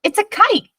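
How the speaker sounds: put-on high, delighted child's cry; a boy realising grandpa made a kite; exclaiming. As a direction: burst out with happy surprise. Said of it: "Jax exclaimed".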